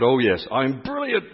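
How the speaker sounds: loud, high, drawn-out exclamation; hammed-up delighted answer; elated reply. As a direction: exclaim it slowly with big British gusto. Retold elated answer after revelation.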